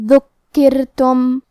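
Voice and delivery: ذکرتم recited slowly with thin, light ra and doubled kaf. Shown as a demonstration. Teacher shows thin ra to students, slow careful recitation. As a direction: say ذکرتم drawn out, ra kept thin. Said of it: "را کو پتلی آواز میں پڑھنا ہے".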